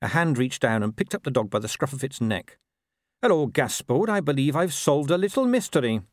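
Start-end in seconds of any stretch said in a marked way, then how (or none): 3.2-6.0 put-on higher, earnest young watchman; cheerful watchman. A narrator's reading. Watchman who has solved a little mystery, higher and cheerful. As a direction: greet the dog as if you've caught it out. Narrator voices Carrot.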